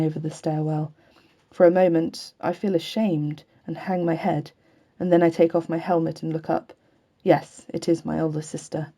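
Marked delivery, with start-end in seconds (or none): none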